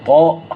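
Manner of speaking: "طاء" with a loud, heavy emphatic t; emphatic taa. Shown as a demonstration. Teacher shows what the following letter turns into, emphatic "طاء". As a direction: hit the thick t of "طاء" hard. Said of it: "التاء اللي بعدها".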